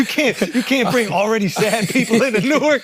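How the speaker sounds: barely holding in the laugh; laughing while joking. Real, not acted. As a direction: crack up while landing the joke. Joking through his own laughter.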